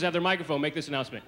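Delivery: ordinary delivery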